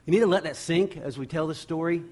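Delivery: ordinary delivery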